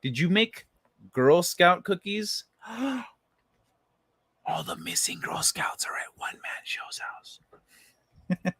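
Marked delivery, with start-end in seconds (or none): 2.5-8.5 hushed, slow scandalized whisper; gasp, then whisper it like a dark secret. Acted. Joking to a viewer about missing Girl Scouts, slow whisper. Gasped conspiratorial joke to chat.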